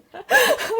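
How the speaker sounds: sudden high squealing laugh; burst of laughter. Real, not acted. To the host, laughing off nearly crying with a high laugh.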